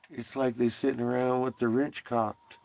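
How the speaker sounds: usual speaking voice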